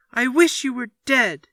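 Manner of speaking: put-on high, loud furious child's scream; screaming at father. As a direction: hurl it at your father. Boy screams at his father.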